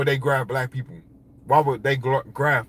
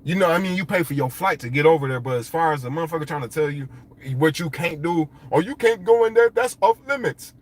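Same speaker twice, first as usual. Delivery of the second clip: normal talking voice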